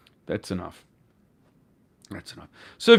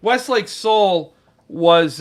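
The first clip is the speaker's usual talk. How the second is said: ordinary delivery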